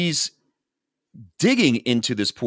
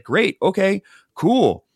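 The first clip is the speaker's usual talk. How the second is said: eager relief; 10 semitones higher, 3 dB louder, slower than usual. Retold or imitated. Wife eagerly welcomes his reconciliation.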